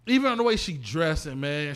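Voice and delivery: usual speaking voice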